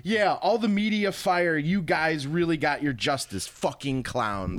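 mock-grand reading of an online rant; hammed-up, theatrical read-aloud; dramatic reading. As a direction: declaim it with drawn-out emphasis. Reads banned player's post aloud theatrically.

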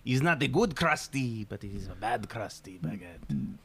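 slow, drawn-out mock French; bad French accent. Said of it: "not French accent".

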